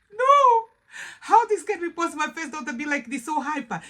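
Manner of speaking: put-on can't believe your own child is this wild; disbelief. A mother stunned by her hyper first daughter, crying out in disbelief. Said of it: "I was like".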